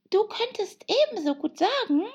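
very high-pitched animal voice; March Hare, chiming in. Acted. March Hare backing up the Hatter, high and insistent.

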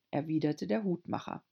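ordinary delivery, nothing marked

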